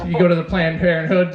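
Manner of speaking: ordinary talk, nothing marked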